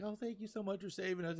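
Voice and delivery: high, soft grateful coo; gushing thanks. Retold or imitated. Nami thanking Franky for saving them, softly gushing.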